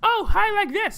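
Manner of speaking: very high squeaky voice; high kid voice. Retold or imitated. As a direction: mimic your old unbroken voice, way up high. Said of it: "My voice was like".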